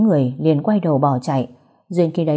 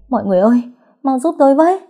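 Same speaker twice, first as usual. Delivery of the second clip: high frightened cry for help, much louder; screaming for help; 8 semitones higher, 5 dB louder, much faster than usual. A narrator's reading. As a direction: call out to the neighbours in panic. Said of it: "mà hét lên".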